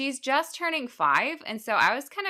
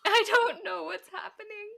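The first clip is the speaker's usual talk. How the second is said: laughing; 11 semitones higher than usual. Real, not acted. Laughing helplessly.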